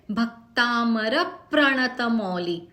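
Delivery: slow Bhaktamar प्रणत Mauli with the short syllables before clusters stressed; slow stressed demo of Bhaktamar प्रणत Mauli. Shown as a demonstration. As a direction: lean on भ and र before the clusters, slowly. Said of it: "स्वर का उच्चारण जोर लगाएंगे".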